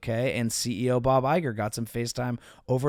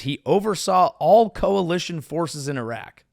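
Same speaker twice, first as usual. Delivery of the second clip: ordinary talk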